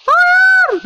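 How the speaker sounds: very high, loud falsetto cry; mock panicked squeal. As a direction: squeal it out in pretend terror. Pleading not to be killed.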